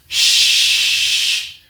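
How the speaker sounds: long hushing "shh" of a slide; sliding sound. Retold or imitated. Popcorn showing how he slid the glasses, hissed sliding noise. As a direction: imitate a smooth slide with a drawn-out shh. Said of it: "like this".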